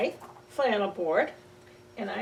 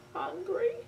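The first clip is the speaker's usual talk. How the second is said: put-on high rising child's plea; a small child whining to mama; whiny hungry child; 8 semitones higher, 6 dB quieter than usual. Child begging mama for food, high and whiny.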